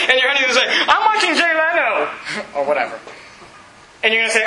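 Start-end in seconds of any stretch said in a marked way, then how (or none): 0.9-2.1 high-pitched brush-off; mock annoyed spouse. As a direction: wave off your spouse from the TV. Acting a dismissive spouse watching TV.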